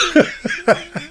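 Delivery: flustered high laugh. Real, not acted. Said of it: "I've gone all red and sweaty now".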